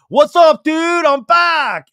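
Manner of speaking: very loud, high bro greeting; chipper Sandoval shout. Retold or imitated. Sandoval returning to the backyard, loud chipper bro shout. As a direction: burst in all chipper. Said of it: "Sandoval's like".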